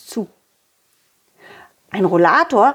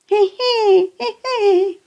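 high little giggle; giggling; 11 semitones higher, slower than usual. Acted. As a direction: giggle brightly. Giggle in story.

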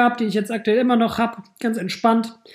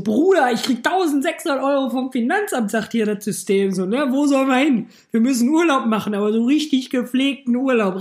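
excited; 5 semitones higher than usual. Retold or imitated. Excited call to friend about refund.

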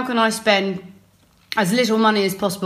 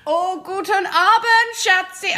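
put-on high, slow German greeting in an accent; Turkish shopkeeper accent; 9 semitones higher, slower than usual. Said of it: "I can't do the Turkish accent".

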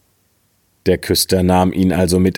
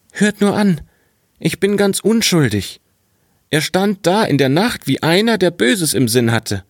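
put-on much higher, innocent boy pleading his case; protesting boy; 9 semitones higher than usual. Boy insists to his scolding father he is innocent, high pleading voice. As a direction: pitch way up, the wide-eyed innocent boy.

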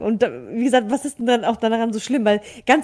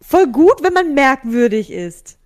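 louder, emphatic; 3 semitones higher, 7 dB louder, slower than usual. Real, not acted. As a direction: press it home with energy. Emphatic praise of being weird. (nachdrücklich)

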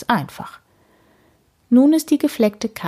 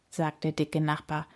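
usual speaking voice

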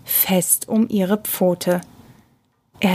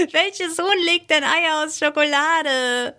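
high laughter spilling into the question; tickled, laughing incredulity; laughing hard; 8 semitones higher than usual. A narrator's reading. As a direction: ask it through helpless, high-pitched laughter. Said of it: "Michi musste lachen".

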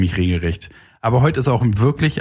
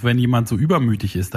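normal talking voice

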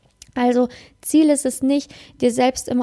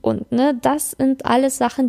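ordinary talk, nothing marked

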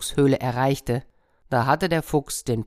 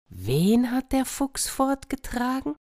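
high puzzled cat voice; cat asking; 11 semitones higher than usual. Acted. Cat asking the hen, high and puzzled. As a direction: ask it high and bewildered. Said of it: "Die Katze".

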